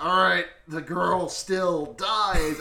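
loud, slow, drawn-out mock decree; hammed-up grandma. Grandma after nothing happened at sunrise, hammed-up menacing order. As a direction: stretch every word into a melodramatic threat.

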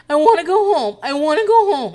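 put-on sad baby voice, high and loud; Renee. Recapper acting out woman pleading with Junior, sad baby voice. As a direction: whine it like a sad baby, imitated. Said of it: "real weird, sad baby voice".

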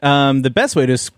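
ordinary talk, nothing marked

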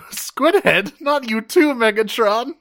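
high-pitched, wounded disbelief; mock indignation. Host retelling Thrust's reply to Megatron, high mock indignation. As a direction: imitate an affronted underling squeaking up high.

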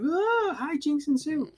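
very high mock-zany exclamation; hammed-up silly trailer voice; wacky, goofy. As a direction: squeal it like a parody of slapstick. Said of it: "it's wacky, goofy".

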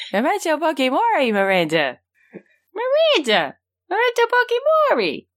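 high-pitched, far-from-own character voice. Acted. Co-host doing a requested character bit for City Girls, high squealed impression. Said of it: "One in [name] voice for City Girls".

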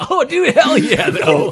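high, loud laughing cheer; excited laughing. Real, not acted. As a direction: whoop it out through a laugh. Excited reaction to dream match.

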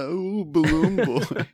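high, slow, drawn-out teasing; mock taunting. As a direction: tease in a loud sing-out high voice. Mocks the balloon boy character.

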